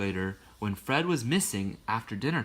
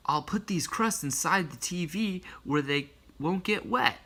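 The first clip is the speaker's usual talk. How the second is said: put-on higher young boy voice; lightly raised boy voice; boy's voice; 7 semitones higher than usual. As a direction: voice Fred as a little boy. Fred's thoughts voiced.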